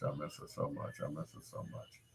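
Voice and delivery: breathless whisper; whispered grief. Real, not acted. Missing her mother, whispered breakdown. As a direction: let it fade to a whisper.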